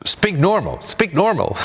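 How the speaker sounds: high-pitched mock scolding; scolding French teacher. French teacher telling off a pupil she thinks mocks her, high-pitched. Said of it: "she thought I was making fun".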